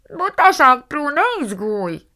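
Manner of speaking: high, loud, smothered voice; muffled through cabbage. A narrator's reading. Margó answers Prue from under cabbage leaves, muffled. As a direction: call out slowly as if through leaves. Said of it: "szűrődött át Margó hangja a káposztán".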